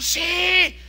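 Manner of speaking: high, loud roar of agreement; crowd cheer. Retold or imitated. Excited crowd answering the organizer, loud high cheer. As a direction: cheer back like a whole stadium. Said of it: "Y todos empezaron".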